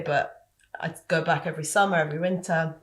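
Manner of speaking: ordinary delivery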